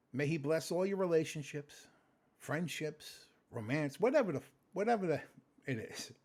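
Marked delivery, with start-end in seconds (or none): none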